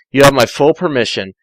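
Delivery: ordinary delivery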